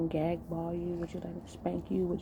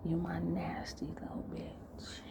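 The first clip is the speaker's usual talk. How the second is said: slow, very soft whisper; whispered possessive talk; 12 dB quieter than usual. Acted. Man whispering into partner's ear, slow possessive whisper. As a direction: whisper it slowly, drawing out each word. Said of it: "I'm gonna whisper and say".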